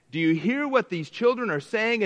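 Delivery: acted indignant questioning. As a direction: re-enact the upset accusers' question. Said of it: "they're mad".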